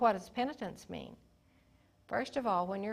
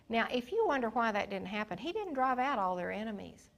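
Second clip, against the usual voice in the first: ordinary talk, nothing marked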